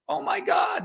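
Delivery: playful fake surprise; mock shock. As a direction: act stunned by the mosquito. Mock shock at mosquito fact.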